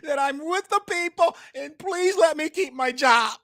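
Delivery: high, mock pleading politician voice. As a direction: whine it like a fake concerned politician. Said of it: "they go another one".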